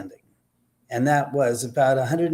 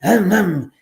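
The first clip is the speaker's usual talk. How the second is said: loud, higher ahem ahem; comic throat clear; mock throat clearing; 5 semitones higher, 5 dB louder than usual.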